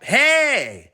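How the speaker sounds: loud high shout; angry. Acted. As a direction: bark it out when bumped. Bumping into barber.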